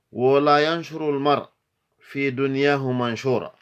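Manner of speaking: loud, high, brisk verse delivery; Arabic poem recitation. Acted. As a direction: declaim each verse high and quick, pausing between lines.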